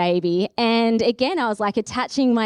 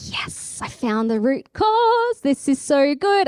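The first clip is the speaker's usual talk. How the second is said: triumphant relief; 5 semitones higher, slower than usual. Retold or imitated. Retold joy at finding cause.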